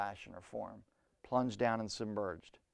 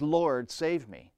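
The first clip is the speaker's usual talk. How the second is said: slow desperate plea; 4 semitones higher, 4 dB louder, much slower than usual. Acted. Peter desperate, drowning.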